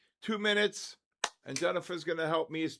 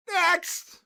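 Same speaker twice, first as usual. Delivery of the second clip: sharp, much higher shout; loud call; 13 semitones higher, 8 dB louder than usual. Real, not acted. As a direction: bark it out to move on. Auction call, no bidder.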